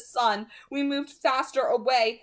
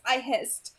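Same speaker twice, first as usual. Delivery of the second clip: ordinary delivery, nothing marked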